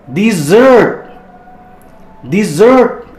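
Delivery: dessert with stress on zert, di-ZERT. Shown as a demonstration. Teacher drills dessert, stressing zert. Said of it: "Ini yang ditekan zert ya".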